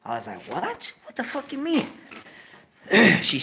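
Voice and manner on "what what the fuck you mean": high, incredulous what; disbelieving outburst; retelling a shocked reaction. Retold or imitated. Shocked reaction in retold story.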